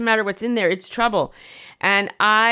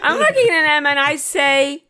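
higher and louder, laughing through it; 8 semitones higher, 5 dB louder than usual. Real, not acted. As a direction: crack up as you say it again. Repeat breaks into laughter.

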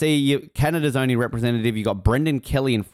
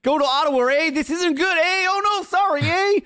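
mock Canadian accent. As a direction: overdo a Canadian accent, high and playful. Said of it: "That sounded like Frank or Barry".

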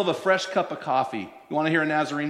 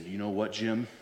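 put-on quiet, grave older pastor; serious pastor; 9 semitones lower, 8 dB quieter than usual. To young pastor Jim, quiet and grave. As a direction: lower the voice like a stern elder. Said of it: "he got really serious".

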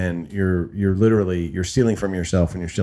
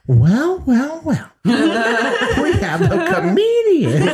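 high, drawn-out mock announcement; slow, teasing public unmasking; gloating boss; 4 dB louder, much slower than usual. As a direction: imitate a boss savoring the catch. Acting boss exposing her publicly.

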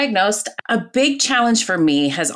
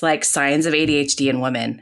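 ordinary talk, nothing marked

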